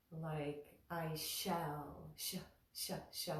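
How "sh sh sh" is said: voiceless, softer sh, sh, sh; whispered sh. Shown as a demonstration. Teacher demonstrating the sibilant, softer. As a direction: hiss the sh quietly. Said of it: "and it goes like this".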